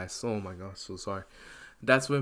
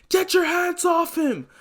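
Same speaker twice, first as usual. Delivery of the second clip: furious yell; 6 dB louder than usual. Acted. Izuku raging at villains.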